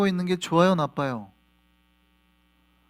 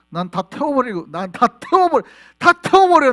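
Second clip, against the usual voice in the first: rising from firm to a high, loud shout; God's threat building to a roar; building divine fury; 6 semitones higher, 10 dB louder than usual. Acted. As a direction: escalate into a furious shout. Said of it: "이렇게 말해요".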